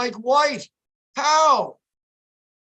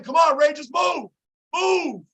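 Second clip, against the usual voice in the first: urging shout; 6 semitones higher than usual. Real, not acted. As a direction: push them on with a yell. Urging team during play.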